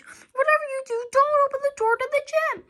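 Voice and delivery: high, fast, loud pleading warning; urgent warning. A narrator's reading. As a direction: beg them not to open it. George warns urgently.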